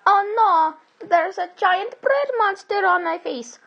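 high, alarmed cartoon yell; Mario yelling. A narrator's reading. Mario panics about a bread monster on his face, yelling. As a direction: cry out in high-pitched alarm. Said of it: "yelled Mario".

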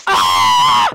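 sudden loud scream, very low. Acted. Baby Bubbles ends her tantrum, screaming. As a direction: let out a huge tantrum scream.

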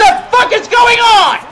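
high, loud incredulous shout; real exasperated swearing shout; outraged outburst. Real, not acted. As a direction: yell in angry disbelief at the game. Outburst at a bad play.

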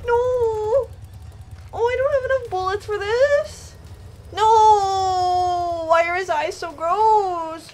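loud, high, drawn-out cries; panicked wailing. Real, not acted. Player out of bullets facing enemies, panicked wail. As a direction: wail in dismay at the top of the voice.

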